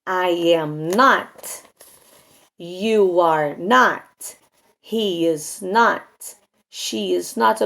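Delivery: slow English model sentences with pauses between; drawn-out classroom grammar drill; language drill recital. Shown as a demonstration. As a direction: recite each negative form deliberately for learners. Models the negative forms.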